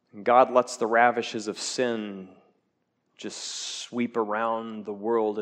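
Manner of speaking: ordinary talk, nothing marked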